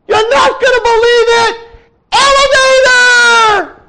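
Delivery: very high, very loud scream with a long drawn-out Elevator; screaming across a distance. A narrator's reading. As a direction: yell it down to a friend far below, stretching the last word. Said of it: "he screams at the top of his lungs".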